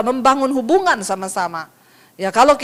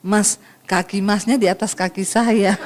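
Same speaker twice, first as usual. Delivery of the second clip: patient Solo person; 4 semitones lower than usual. Acted. Solo person with trodden foot, low and mild. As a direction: point it out meekly and very gently. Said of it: "itu kalau orang Solo".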